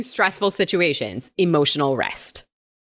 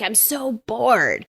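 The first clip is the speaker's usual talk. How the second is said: put-on bored kid; 3 semitones higher, slower than usual. Acting out bored kid's complaint.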